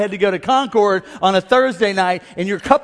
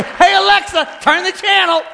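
much higher, raised call across the room; parent calling out; 9 semitones higher than usual. Retold or imitated. Parent hollering at kid to change channel. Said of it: "they'd say".

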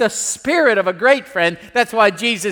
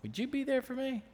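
much softer, tender question; hushed question; 5 semitones higher, 16 dB quieter than usual. Retold or imitated. Wife asking her husband beside her, hushed. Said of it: "Jackie looked over at me and like".

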